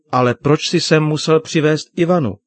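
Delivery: acted hurt protest, no sobbing; tearful question. A narrator's reading. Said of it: "zeptala se se slzami v očích".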